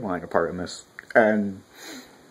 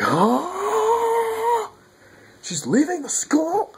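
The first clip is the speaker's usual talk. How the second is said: put-on much higher, slow, surprised girl voice; girly character voice; much slower than usual. Fan voicing a shocked schoolgirl, high and slow. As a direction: squeak it out like a startled schoolgirl.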